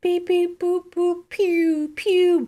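high beeping robot voice. Pretend. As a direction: beep like a little robot robot voice. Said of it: "Robot voice".

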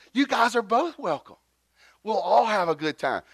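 high-pitched, gushing welcome; exaggerated welcome. Preacher welcoming father and son, exaggerated high welcome. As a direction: act out an over-eager host. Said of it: "This is what I look like".

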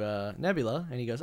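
ordinary talk, nothing marked

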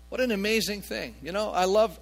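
usual speaking voice

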